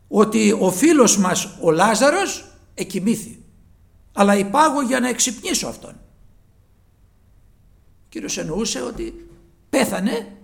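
loud, high pleading sermon voice; preaching at full force. Acted. Preacher urging listeners to pray to see the Lord, fervent full-force preaching. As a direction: urge them to ask God, rising to a plea.

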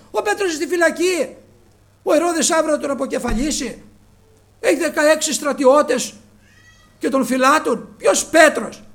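loud, high, impassioned preaching; preaching at full force. Acted. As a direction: thunder the story out to the congregation. Preacher retelling Rhoda and brothers at full force. (κήρυγμα με πάθος, δυνατά)

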